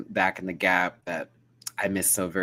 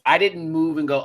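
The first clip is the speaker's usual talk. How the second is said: ordinary talk, nothing marked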